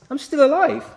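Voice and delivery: high, loud gasp of being alive; amazed relief. Acted. Paul waking after the stoning, astonished and loud. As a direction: cry out in wonder at surviving. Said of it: "But he's".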